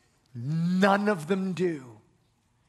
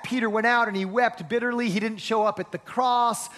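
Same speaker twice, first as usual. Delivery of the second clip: ordinary talk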